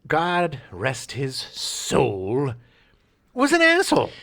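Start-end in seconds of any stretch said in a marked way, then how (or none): 3.3-4.1 loud exclamation. Real, not acted. Outburst after mock reverence.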